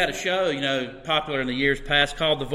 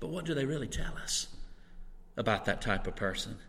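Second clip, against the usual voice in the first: much softer, quicker repeat of the question; 4 semitones lower, 10 dB quieter than usual. Real, not acted. Preacher echoes his question to the congregation, hushed. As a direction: let "But what do they really tell us" drop to a hushed, faster echo.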